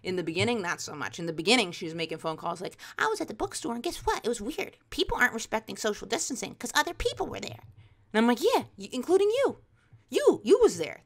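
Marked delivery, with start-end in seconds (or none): 3.0-7.6 high-pitched chatty mom impression; imitated mom. Mom reporting on a phone call, high mock-mom chatter. 8.4-11.0 high, punchy mock exasperation; exasperated retort. Kid calling out mom, exasperated retort.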